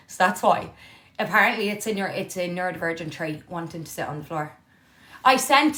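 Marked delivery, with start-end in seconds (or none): none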